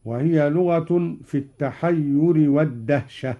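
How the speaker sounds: ordinary talk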